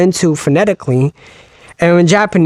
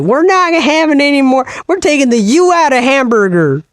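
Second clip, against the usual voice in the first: high, hammed-up old-timey American; defiant folksy American caricature, pitched up; American prospector voice; 9 semitones higher than usual. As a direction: rant like a cartoon prospector. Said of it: "every American sound like a prospector".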